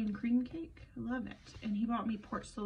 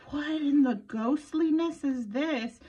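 mock spooked; 5 semitones higher, 7 dB louder, slower than usual. Spooked reaction to lights coming on.

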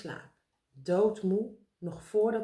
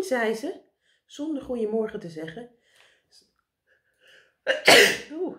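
ordinary delivery

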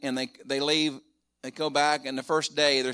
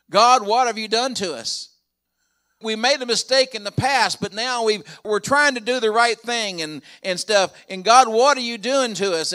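louder, higher, pleading outcry; brothers' anguished cry; 7 semitones higher, 7 dB louder than usual. Retold or imitated. Brothers crying out to God over their trouble, loud anguished plea. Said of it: "And then his brothers".

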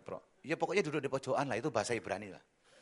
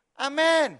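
ringing high amen; loud amen; 14 semitones higher, 15 dB louder than usual. Real, not acted. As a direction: call out amen to the crowd. Preacher calls for amen.

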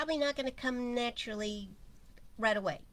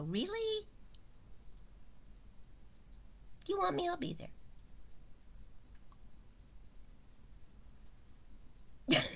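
high, teasing little voice; a high mock-incredulous shoulder voice; little chiding voice; 10 semitones higher, 3 dB quieter than usual. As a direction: playfully scold a whiner. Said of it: "my little voice would go".

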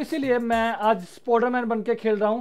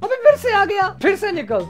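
high taunt; 8 semitones higher, 3 dB louder than usual. Pretend. Gloating at an enemy coming back.

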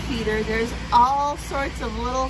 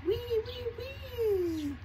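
high, held thin whine; mosquito whine; 13 semitones higher, 4 dB quieter, much slower than usual. Acted. Mosquito in the lullaby crying near baby, high whine.

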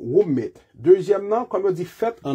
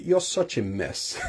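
softer teasing You're such a mess; teasing joke; 3 semitones lower, 6 dB quieter, slower than usual. Shown as a demonstration. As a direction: tease a friend who made a joke. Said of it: "moun nan kan bay blag". (ap fè blag, pi dousman)

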